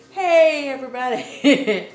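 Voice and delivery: loud, laughing hello to viewers; excited greeting. Real, not acted. Opening her YouTube video, loud excited greeting. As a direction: burst in with a big laughing hello.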